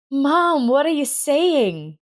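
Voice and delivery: a daughter stunned by what she hears; amazed. A narrator's reading. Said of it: "Rita was genuinely amazed".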